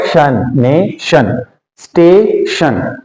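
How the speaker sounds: "नेशन" and "स्टेशन" very slow, split before शन; syllable-by-syllable blending. Shown as a demonstration. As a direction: sound out "शन", then "ने-शन", "स्टे-शन" with gaps. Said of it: "और ये क्या हो गया". ("नेशन", "स्टेशन" को धीरे-धीरे, शन अलग करके बोलना)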